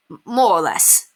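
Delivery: stammering the M, sheepish and grinning. A narrator's reading. Simon grinning sheepishly as he says goodbye to Penelope. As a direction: stumble bashfully over the first word. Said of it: "Simon grinned sheepishly".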